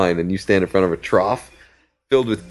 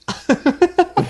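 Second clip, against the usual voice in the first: high squeaky giggle. Retold or imitated. Manny's laugh imitated.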